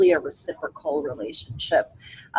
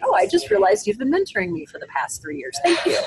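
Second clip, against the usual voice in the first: high, touched, near tears at the end; grateful realization; 12 semitones higher than usual. Pretend. Mentee suddenly thanking a longtime mentor, choked-up and grateful. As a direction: gratefully thank them, voice catching.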